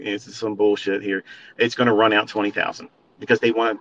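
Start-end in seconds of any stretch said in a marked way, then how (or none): 0.0-1.2 low, soft muttered quote; quietly retold scoff; muttered aside. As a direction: mutter it to yourself, amused. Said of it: "I was like".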